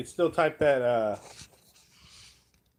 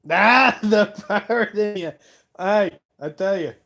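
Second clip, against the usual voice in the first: a hint of an Irish accent, louder and higher; Irish accent slipping out while joking with the chat; 7 semitones higher, 10 dB louder than usual. Real, not acted. Streamer to his viewers, a light Irish accent. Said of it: "A daggum Irish accent".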